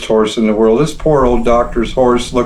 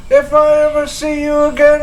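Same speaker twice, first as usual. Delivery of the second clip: much higher yell, far away; distant yell. Acted. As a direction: call it out as he rides off. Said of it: "Tarleton yelled".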